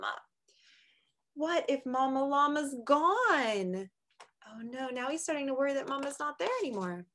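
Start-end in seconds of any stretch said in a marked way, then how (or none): none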